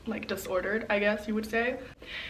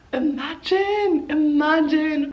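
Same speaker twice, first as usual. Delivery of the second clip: higher, louder, slow disbelieving imagine; incredulous outburst; 6 semitones higher, 7 dB louder, much slower than usual. Real, not acted. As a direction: stretch it out, still mortified. Reliving embarrassing moment.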